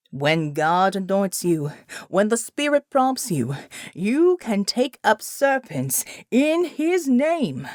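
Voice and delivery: slow, rhythmic revival sermon; preaching. Acted. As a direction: proclaim it to the flock, building. Pastor lifts the snakes.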